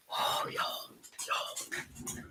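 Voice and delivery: breathy exasperated whisper to the viewers. Real, not acted. Whispering to viewers, worn out by the puppy, exasperated whisper. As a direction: sigh it out under your breath.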